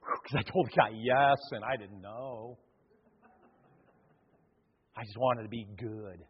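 a hint of a sheepish disciple murmuring his relief; relieved Peter. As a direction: Peter quietly relieved he got away with it. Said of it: "Can you see Peter going".

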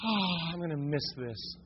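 soft, breath-heavy sigh of longing; wistful sigh. Acted. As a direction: sigh it out quietly, reluctant to leave. Acting Lot's wife looking back.

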